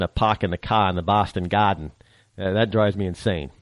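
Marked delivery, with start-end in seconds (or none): 0.0-1.9 r-less Boston accent; lower-pitched Boston "pahk the cah". Shown as a demonstration. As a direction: drop the r's like a Bostonian. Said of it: "it's kinda like the Boston accent".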